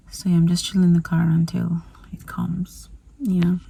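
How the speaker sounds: soft whisper in the car; hushed aside while waiting. Real, not acted.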